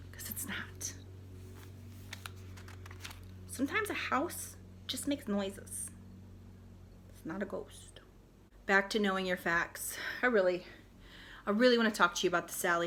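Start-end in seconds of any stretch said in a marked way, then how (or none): 0.1-8.0 whisper. Real, not acted. Debunking aside.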